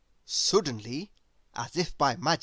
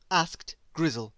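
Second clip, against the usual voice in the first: ordinary delivery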